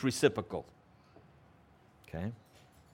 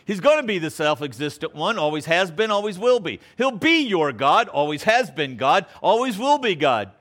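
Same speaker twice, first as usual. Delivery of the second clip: rhythmic preaching refrain; 5 semitones higher, 6 dB louder than usual. Acted. Preaching refrain, higher and louder.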